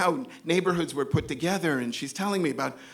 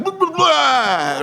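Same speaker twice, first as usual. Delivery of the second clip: loud high retching "bleh"; stuttered gags bursting into a long heave; imitated vomiting; 10 semitones higher, 8 dB louder, much slower than usual. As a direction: act out throwing up, loudly. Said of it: "she gets triggered".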